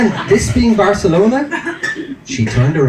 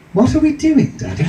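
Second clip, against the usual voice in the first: imitated small child, much higher; little voice; 9 semitones higher than usual. Toddler on dad's shoulders piping up, imitated little high voice. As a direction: pipe up in a little kid's voice. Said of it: "a little voice just kinda went".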